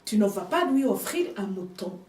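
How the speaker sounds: ordinary talk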